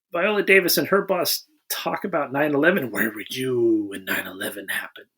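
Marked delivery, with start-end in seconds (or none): 2.9-5.1 hushed quote. Retold or imitated. Quoting bosses talking about nine eleven.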